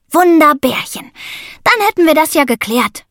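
put-on high, satisfied boy's voice; a kid sealing a cuddle deal; pleased Sammy. A narrator's reading. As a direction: settle it with smug delight.

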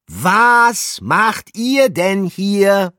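high, slow, loud drawn-out shout. A narrator's reading. Pikandu repeats his question to the rats, slow loud shout. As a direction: yell it so they hear through the glass. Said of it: "wiederholte seine Frage etwas lauter".

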